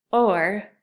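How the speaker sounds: ordinary delivery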